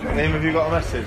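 playful loud prompt to a mate; calling out. Real, not acted. Egging Liam again.

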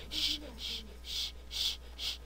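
repeated soft shh, shh; quiet hissed hushes; imitated shushing. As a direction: mimic a sister shushing you. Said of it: "mi hermana me anda diciendo".

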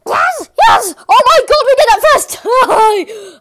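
normal talking voice

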